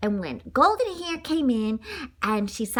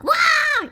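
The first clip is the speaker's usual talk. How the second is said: high loud startled "wah"; a sudden frightened yelp; 6 dB louder than usual. Acted. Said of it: "blurted out".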